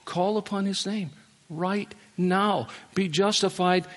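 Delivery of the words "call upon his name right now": normal talking voice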